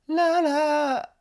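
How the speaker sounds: ララー sung high and slow, ending in a creaky edge; vocal fry. Shown as a demonstration. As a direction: stretch out ララー and let the end crackle into fry. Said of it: "みたいな". (エッジボイスのララー)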